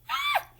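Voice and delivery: very high falling shriek. A narrator's reading. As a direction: shriek as he topples. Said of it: "there was a shriek".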